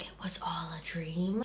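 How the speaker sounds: hushed secret whisper; whispered spoiler. Real, not acted. Spoiler aside.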